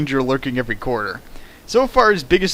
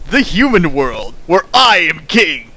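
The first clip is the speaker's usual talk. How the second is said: hammed-up grand villain boast, higher and louder; mock megalomaniac; 4 semitones higher, 5 dB louder than usual. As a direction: crown yourself king of the world. Mocking the protagonist's self-importance.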